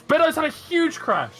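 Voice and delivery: high, loud alarmed outburst; shocked shout. Real, not acted. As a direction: blurt out the crash in shock. Crash seen live.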